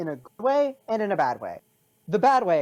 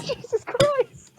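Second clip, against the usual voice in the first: laughing exclamation; much slower than usual. Real, not acted. Still laughing at the joke.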